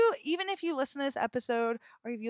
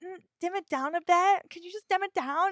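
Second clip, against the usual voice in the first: higher mock scolding voice, dim it down; mocking critic; 6 semitones higher, 5 dB louder, faster than usual. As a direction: hold up a snooty critic's request. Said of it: "or just".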